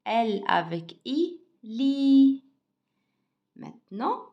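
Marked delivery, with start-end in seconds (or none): 1.6-2.4 "li" high and loud with a long I; pronunciation demo. Shown as a demonstration. Teacher joins L with I for pupils, "li" long and loud. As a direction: hold the vowel of "li" out strongly. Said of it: "comment je prononce?".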